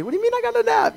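a student who can't believe the grade; high-pitched outraged disbelief; indignant student. Retold or imitated. Student protesting a failing grade.

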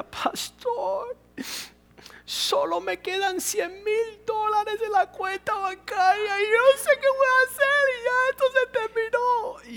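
high, wailing plea of a broke widow; desperate woman's plea. Retold or imitated. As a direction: cry it out high like a frightened old woman. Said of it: "estaba desahuciada y decía".

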